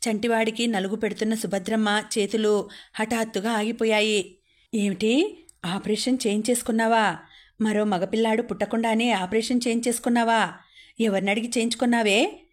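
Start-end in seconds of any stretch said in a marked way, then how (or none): none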